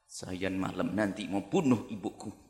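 low, soft mock confession; half-whispered sinister announcement; hushed mock confession. Child announcing he'll kill mother. (pengakuan pelan dan lirih)

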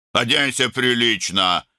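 high-pitched, snappish command; barked order. A narrator's reading. Older brother ordering younger to dress properly, barked. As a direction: bark it as an order. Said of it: "рявкнул Арун".

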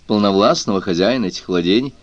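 ordinary delivery

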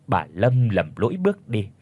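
ordinary delivery, nothing marked

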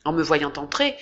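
usual speaking voice

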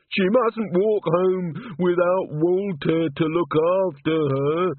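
high, slow, alarmed outburst; fretful shout. Acted. Walter fearing for his mum, alarmed high shout. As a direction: Walter crying out in sudden horror, high and slow. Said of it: "he shouted".

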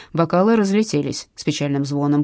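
ordinary delivery

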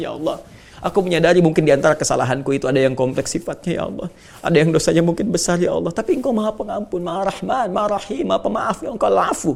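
earnest pleading to God, lightly voiced; a hint of humble supplication; heartfelt prayer. Shown as a demonstration. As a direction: pray it sincerely, asking forgiveness.

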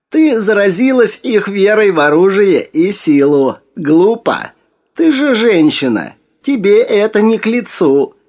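high, loud scolding crone voice; reproachful old woman. A narrator's reading. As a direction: scold her like a wise old hag. Old woman continues lecturing.

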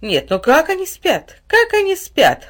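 put-on slow, marvelling over the sleeping children; admiring. A narrator's reading. Mother gazing at her sleeping children, admiring. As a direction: savour each word, delighted. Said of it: "восхищённо покачала головой мать".

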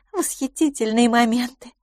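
high, coaxing voice; pleading. A narrator's reading. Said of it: "просительно продолжала мисс Лафос". (просительно, высоким голосом)

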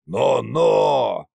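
mock-menacing drunken warning; threatening drunk tsar. A narrator's reading. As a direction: warn off a mirror like a tipsy tsar. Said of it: "погрозил большому зеркалу царь-батюшка". (грозно, пьяным голосом)

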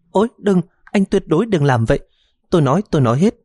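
high, frightened begging; panicked plea. A narrator's reading. Daughter panicking at his threat, high frightened plea. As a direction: beg in a rush of fear. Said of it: "hoảng đến nỗi chắp hai tay".

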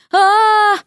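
high, drawn-out "aaah"; frustrated wail. A narrator's reading. Frog Vasya flopping onto her belly, wailing. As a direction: let out a high cry.

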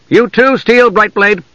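high, firm order to one man; single him out commandingly; sharp command. A narrator's reading. Half-elf orders the knight to disarm, sharp command. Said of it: "the sharp command".

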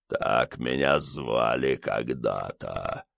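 deep, slow otherworldly rumble; dark lord. A narrator's reading. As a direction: rumble it like a voice from the grave. Skull monster speaks.

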